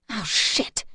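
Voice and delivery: gasped. A narrator's reading. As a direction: choke it out in sudden panic. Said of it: "the human gasped".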